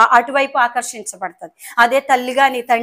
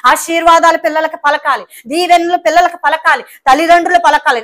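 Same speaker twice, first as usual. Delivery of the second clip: higher, louder preaching voice pouring out blessings; fervent preaching; 4 semitones higher, 4 dB louder than usual. Acted. Pastor urging parents to bless their children, fervent raised preaching. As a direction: proclaim each blessing with conviction.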